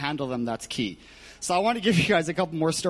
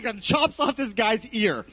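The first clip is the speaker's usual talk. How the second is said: normal talking voice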